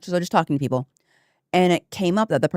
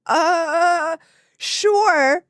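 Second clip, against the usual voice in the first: high-pitched mock awkward agreement; imitating hesitant man; 14 semitones higher, 4 dB louder than usual. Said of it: "And he was like".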